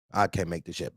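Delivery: normal talking voice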